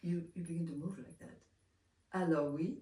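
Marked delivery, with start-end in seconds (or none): 2.2-2.8 French teacher speaking French, louder; French accent. Retold or imitated. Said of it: "speak with a French accent".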